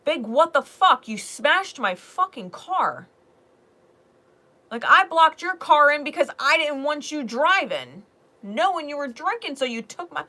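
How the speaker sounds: loud, high, emphatic telling-off; angry confrontation. Acted. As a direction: lay into them over the smashed car, loudly. Acted confrontation over smashed car.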